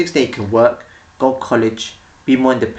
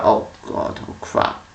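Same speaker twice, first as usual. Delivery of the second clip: mock dismay; 6 dB quieter, slower than usual. Parent realizing their mistake.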